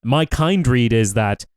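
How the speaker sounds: usual speaking voice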